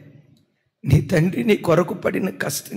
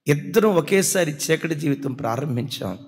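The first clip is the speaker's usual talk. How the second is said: ordinary delivery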